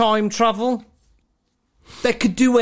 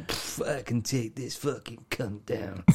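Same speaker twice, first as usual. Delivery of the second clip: low, slow, deadpan macho boast; mock tough-guy brag, low and slow; cocky hero; 9 semitones lower, 13 dB quieter than usual. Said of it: "And then".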